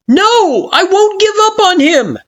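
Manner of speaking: very high, loud refusal; defiant shout. Acted. You refusing Kara in the storm, defiant shout. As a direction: throw it back at her.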